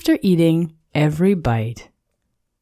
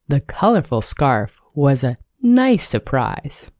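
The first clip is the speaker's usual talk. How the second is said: ordinary delivery, nothing marked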